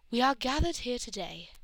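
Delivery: loud, declamatory officiant; ceremonial. A narrator's reading. Priestess begins the wedding, ceremonial.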